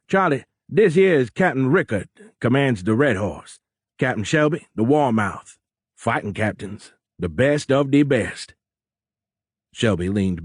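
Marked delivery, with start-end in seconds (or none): 0.1-8.5 boisterous Cajun introductions; swinging Cajun voice with high, loud peaks; thick Cajun accent. A narrator's reading. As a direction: introduce them grandly in Cajun. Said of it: "in a thick Cajun accent".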